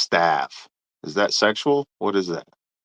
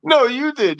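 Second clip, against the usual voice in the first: ordinary talk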